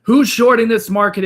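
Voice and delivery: calling out. Real, not acted. Calls out to the chat.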